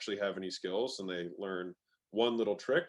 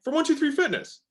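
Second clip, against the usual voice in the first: much higher, rising like a question; 8 dB louder than usual. Shown as a demonstration. Caller naming his gym as an implied question. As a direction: lift it up as if asking. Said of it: "Emphasis goes up".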